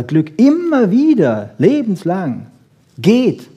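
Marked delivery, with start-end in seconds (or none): none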